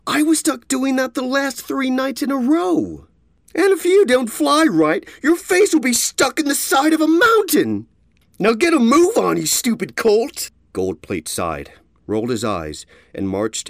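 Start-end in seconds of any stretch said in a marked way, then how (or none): 0.1-3.1 lightly voiced complaining colt; whiny young colt. A narrator's reading. Gold Plate complains. 3.6-10.5 loud, menacing thug; threatening bully. A narrator's reading.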